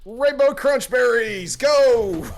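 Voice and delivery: loud, slow, high battle shout; battle cry. Acted. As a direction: call out the attack like an anime hero. Shouting an attack name.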